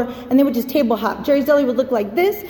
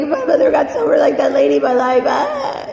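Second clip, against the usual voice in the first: high, loud, slowed wail breaking off into ah; retelling the ugly cry at an AA meeting, wailing; mock wailing; 3 semitones higher, 4 dB louder than usual. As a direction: blurt it out between sobs, hammed up. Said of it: "I'm like".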